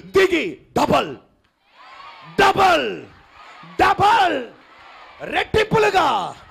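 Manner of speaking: preacher shouting a double blessing to the congregation; preaching at full force. Acted. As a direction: roar the promise of blessing. Preaching climax.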